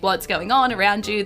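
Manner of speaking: ordinary talk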